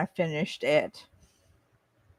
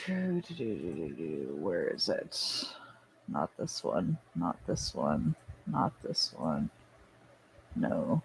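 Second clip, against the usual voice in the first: muttering to self; 12 semitones lower, 10 dB quieter, slower than usual. Real, not acted. Searching for something, muttering.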